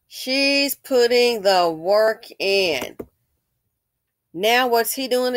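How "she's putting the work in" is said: very slow, every word drawn out; slow emphatic repeat. Real, not acted. Vlogger insisting the woman does the labor, slow and emphatic. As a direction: stretch each word to drive the point home.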